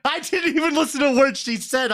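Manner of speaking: real laughter breaking through the words; can barely get it out for laughing. Real, not acted. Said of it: "I'm already laughing".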